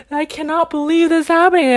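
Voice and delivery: high, loud, exasperated disbelief; retold anger. Filled with anger at the compliance rules, high loud retold exasperation. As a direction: re-enact angry disbelief, voice pushed up. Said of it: "I was filled with anger".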